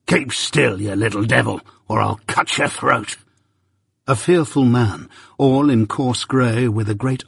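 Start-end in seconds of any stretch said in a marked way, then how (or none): 0.1-3.2 lightly voiced threatening convict; a hint of a gruff criminal; menacing convict. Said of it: "a terrible voice".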